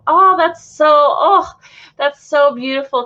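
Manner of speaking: high, loud gush of real feeling; moved exclamation. Real, not acted. Host moved by a viewer's mother-daughter story, moved exclamation. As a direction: exclaim it, overcome by how lovely it is.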